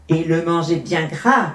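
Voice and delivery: ordinary delivery, nothing marked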